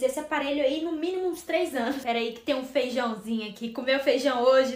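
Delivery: ordinary delivery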